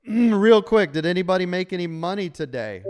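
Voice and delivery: loud, high call to the room. Real, not acted. Coach calls out to his trading chat room, loud and high. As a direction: grab everyone's attention with a loud high call.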